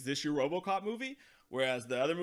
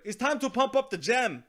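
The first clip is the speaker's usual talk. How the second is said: higher, louder hype line; mock gangster swagger; 6 semitones higher, 6 dB louder than usual. Reviewer mocks Ninja's hype line, higher and louder. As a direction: hype it up like a goofy rapper. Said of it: "he's like".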